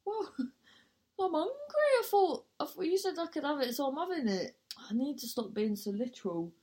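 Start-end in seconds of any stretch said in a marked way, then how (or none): 0.1-4.6 high-pitched retort. Retold or imitated. Retelling her excuse to the audition lady, high and giggly. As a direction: voice pushed up high, half laughing.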